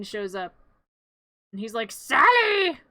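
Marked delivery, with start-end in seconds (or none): 1.9-2.8 high, loud mock holler; angry call. Finkelstein mad at Sally, hollering her name. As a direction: yell the name as a cross master.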